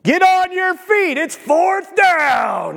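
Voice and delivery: shouting from the stands; rousing shout. Acted. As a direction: whip the crowd up like it's fourth down. Preacher rousing the crowd like sport.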